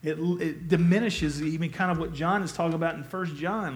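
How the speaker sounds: usual speaking voice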